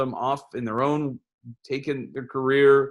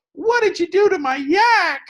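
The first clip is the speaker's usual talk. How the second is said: high, loud outraged cartoon voice; offscreen yak owner voice; 6 dB louder than usual. Yak owner yelling from off screen about his yak. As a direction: squeal it like a wounded cartoon character off screen. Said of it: "you hear a voice from off screen go".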